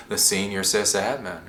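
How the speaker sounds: usual speaking voice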